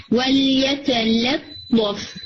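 ولیتلطف very slow, both lams spread and light. Shown as a demonstration. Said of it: "لطف نہیں".

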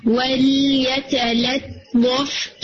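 ولیتلطف very slow, both lams spread and light. Shown as a demonstration. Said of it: "light رکھنا ہے".

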